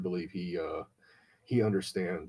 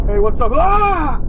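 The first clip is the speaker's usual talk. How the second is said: mock-shouted greeting, much higher and louder; loud greeting yelp; 15 dB louder, slower than usual. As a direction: burst in yelling a greeting then a squeal. Friend's greeting on phone.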